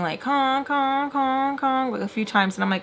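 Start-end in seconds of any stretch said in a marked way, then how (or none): 0.2-1.9 high, held car-horn honks; steady high-pitched honk imitation; imitated car honks. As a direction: mimic a car horn honking repeatedly. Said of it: "I hear someone honking".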